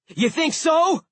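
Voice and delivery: high, loud defiant roar. A narrator's reading. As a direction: challenge the dragon. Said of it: "Blitz roared".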